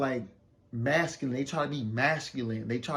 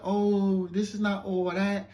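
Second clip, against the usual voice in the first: higher, slow-drawn imitation of a bougie complaint; mock bougie; 6 semitones higher, 3 dB louder, slower than usual. Woman turning her nose up at the hotel, mock bougie. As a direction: mimic her being unimpressed. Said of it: "she's acting mad bougie once again".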